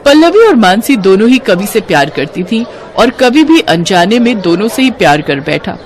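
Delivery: ordinary delivery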